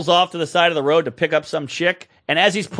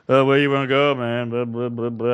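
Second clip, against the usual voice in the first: low, dull mumbled cabbie imitation; dopey cabbie; 5 semitones lower than usual. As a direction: mumble it like a dopey driver, trailing off. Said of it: "I had a real dopey cab".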